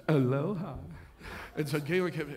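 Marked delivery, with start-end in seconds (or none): 0.1-0.7 mock local greeting. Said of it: "and he".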